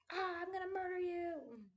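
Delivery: jokey fake fury at the husband over laundry; mock murderous rage. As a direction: pretend to snap at the husband.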